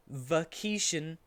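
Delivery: ordinary talk, nothing marked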